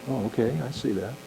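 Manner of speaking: softly acted, placating answer; meek gentle reply. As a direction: drop to a small, quiet voice. Acting out the meek, gentle responder.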